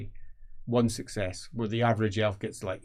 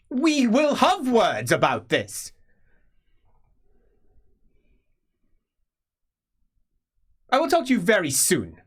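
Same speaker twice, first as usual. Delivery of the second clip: angry warning; 10 semitones higher, 7 dB louder than usual. Acted. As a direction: promise a reckoning, furious. Commander rebukes through the door.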